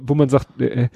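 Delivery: ordinary talk, nothing marked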